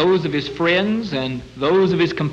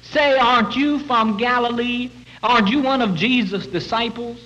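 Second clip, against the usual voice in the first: put-on higher, changed voice of a prying servant girl; second maid accusing; 7 semitones higher than usual. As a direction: lightly play the other maid pressing him. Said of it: "and she says".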